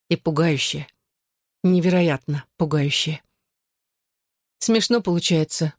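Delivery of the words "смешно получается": ordinary delivery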